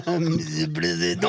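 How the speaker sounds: put-on a light French-accented "président", laughing; imitated French accent. Mocking a friend's French, French accent. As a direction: echo it in a jokey French accent. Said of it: "very good accent".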